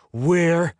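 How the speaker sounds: loud, high, isolated word; furious demand. A narrator's reading. Captive demanding to know their location, furious and emphatic. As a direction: punch the single word out angrily.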